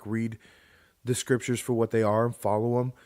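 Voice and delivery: normal talking voice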